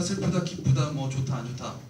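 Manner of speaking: ordinary talk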